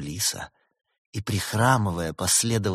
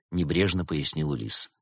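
ordinary delivery, nothing marked

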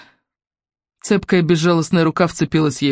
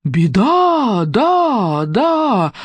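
a gloomy booming echo with fading repeats; 5 semitones higher, much slower than usual. Acted. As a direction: toll the warning from above. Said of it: "мрачно загремело сверху".

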